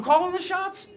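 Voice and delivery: high, mock-astonished question; incredulous echo. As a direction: shoot the pitch way up in disbelief. Incredulously repeats others' notion.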